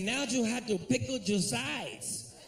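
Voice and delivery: put-on a cashier struggling with the order in a Spanish accent; hammed-up Spanish-accented cashier; mock Spanish accent. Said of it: "He said".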